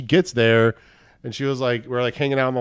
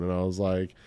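usual speaking voice

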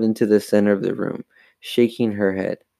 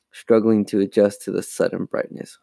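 ordinary delivery, nothing marked

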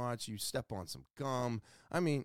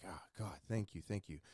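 relieved thanks; 2 semitones lower, 9 dB quieter than usual. Retold or imitated. Relieved the internet works.

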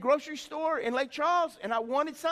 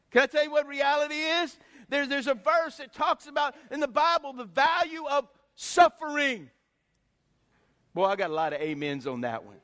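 normal talking voice

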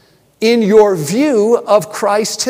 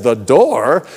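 questioning; 6 semitones lower than usual. Retold or imitated. Listener questions the preacher's odd reason, puzzled. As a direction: echo it back as a puzzled question. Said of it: "she says".

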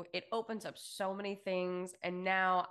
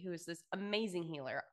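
normal talking voice

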